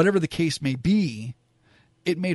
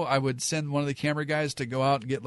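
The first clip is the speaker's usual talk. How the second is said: ordinary delivery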